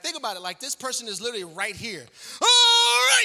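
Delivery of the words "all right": very high, drawn-out shout; screaming coach. Retold or imitated. Coach to players standing right there, screaming. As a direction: bellow it at full volume like a coach. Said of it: "You're, like, screaming".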